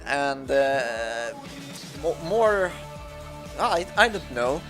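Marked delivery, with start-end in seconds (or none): none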